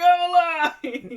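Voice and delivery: high, loud, drawn-out cry; imitated jubilant yell; triumphant mock shout. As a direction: announce it with hammed-up triumph. Paul bursts from water.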